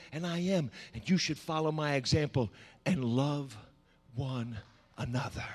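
low, soft, solemn sermon voice; hushed preaching. Real, not acted. Preacher recounting Jesus washing feet, hushed and reverent. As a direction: let it sink down reverently, with pauses.

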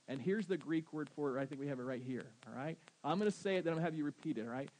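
ordinary delivery

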